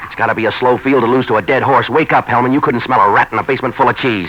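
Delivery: loud sarcastic tirade at the police lieutenant; exasperated shout. Acted. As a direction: yell at him to wake up. Novak berating a stubborn cop.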